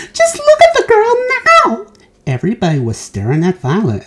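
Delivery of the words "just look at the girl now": very high, loud indignant cry. A narrator's reading. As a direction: cry out in outrage. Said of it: "cried Missus Beauregard".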